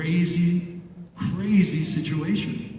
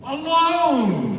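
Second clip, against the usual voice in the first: ordinary talk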